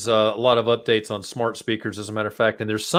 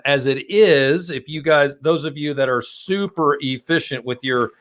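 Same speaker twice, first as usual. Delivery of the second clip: ordinary talk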